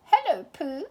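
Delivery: high little squeak of a greeting; squeaky Piglet. A narrator's reading. Piglet squeaking hello back to Pooh. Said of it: "squeaked Piglet".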